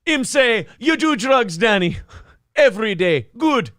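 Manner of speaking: high-pitched Jamaican patter; Jamaican accent. Acted. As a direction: put on a comic Jamaican accent. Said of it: "Him's going to Jamaica".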